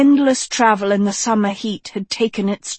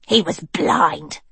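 lower, curt slow snap; snapped; 5 semitones lower, slower than usual. Acted. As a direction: cut her off sharply. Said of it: "snapped Annadil".

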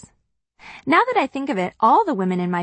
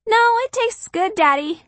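put-on high, bright child voice; sweet little sister; little girl voice; 13 semitones higher, 3 dB louder than usual. Narrator voices little sister Kitty.

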